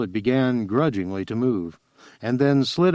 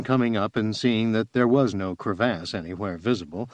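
ordinary delivery

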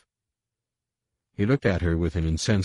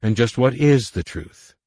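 pressing demand; 6 semitones higher, 7 dB louder than usual. A narrator's reading. Leia presses the intruder.

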